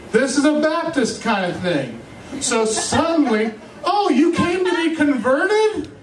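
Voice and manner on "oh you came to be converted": high, sing-song sugary question; hammed-up eager missionary; chirpy mock welcome; mock preachy host. Mocking the Baptist hosts.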